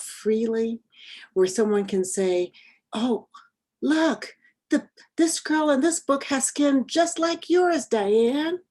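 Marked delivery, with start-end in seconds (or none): 2.9-8.6 put-on high-pitched voice of a child noticing something; excited child. Child showing classmate a book, excited. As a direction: pipe up like a delighted child making a discovery.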